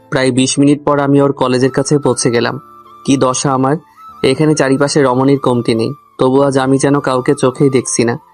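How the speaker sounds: ordinary delivery, nothing marked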